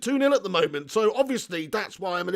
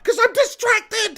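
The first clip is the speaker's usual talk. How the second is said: slow, high, loud 'I'm distracted' yelled; exasperated shout; 13 semitones higher, 4 dB louder, slower than usual. Real, not acted. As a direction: drag it out in a loud frustrated yell. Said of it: "the reason I don't know".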